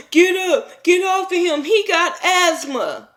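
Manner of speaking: high, whiny mock voice of a pleading girlfriend; hammed-up high pleading imitation; mocking Ashley. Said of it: "this bitch Ashley talking about".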